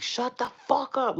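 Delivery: high, loud, drawn-out snap; exasperated mock yell. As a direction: act out losing patience with your daughter. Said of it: "gets on my last fucking nerve".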